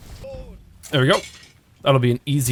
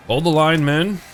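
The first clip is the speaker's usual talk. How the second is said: commander's order; 4 semitones higher, 4 dB louder than usual. Pretend. Commanding troops in battle.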